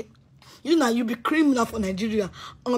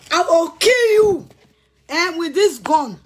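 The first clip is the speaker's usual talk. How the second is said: higher, louder, slow shouted threat; furious threat; 4 semitones higher, 5 dB louder, slower than usual. Real, not acted. Threatening Victor with a gun, shouting slowly. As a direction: roar each word out slowly at him.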